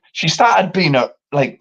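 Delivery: ordinary delivery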